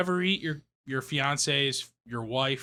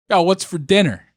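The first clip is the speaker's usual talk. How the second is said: loud casual demand; 4 semitones higher, 9 dB louder than usual. Retold or imitated. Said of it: "be like".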